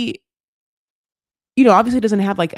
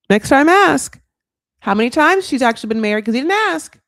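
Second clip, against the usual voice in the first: scolding; 6 semitones higher, 8 dB louder than usual. Real, not acted. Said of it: "scolding the husband".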